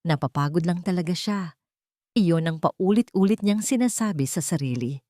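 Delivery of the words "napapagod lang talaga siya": ordinary delivery, nothing marked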